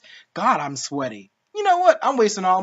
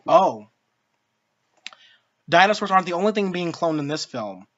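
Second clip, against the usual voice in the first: ordinary talk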